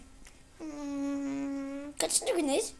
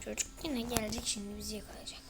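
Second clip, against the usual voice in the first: unvoiced, hushed warning; hiding and whispering in fear; tense whisper; 3 semitones lower, 8 dB quieter than usual. Real, not acted. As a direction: whisper it so the monster cannot hear. Whispering while hiding from monster.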